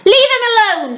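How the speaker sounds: put-on high-pitched boy's scream. A narrator's reading. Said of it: "screamed William".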